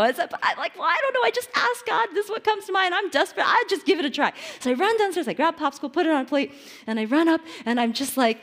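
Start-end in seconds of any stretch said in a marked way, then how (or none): 0.8-4.3 high, hammed-up helpless reply; desperate self-quote. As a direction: play your own flustered desperation. Retelling her own desperate reply.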